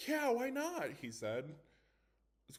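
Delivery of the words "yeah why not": eager agreement. Acted. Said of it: "David smiled".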